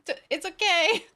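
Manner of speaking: lightly mocking we-come-in-peace voice; mock reassurance. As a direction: pretend innocence while on a dragon. Mock quote of unarmed riders.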